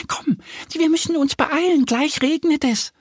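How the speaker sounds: high, squeaky urging voice; urgent little duck. A narrator's reading. Little duck warns the bear of coming rain, urgent. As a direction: hurry a friend along in a high voice.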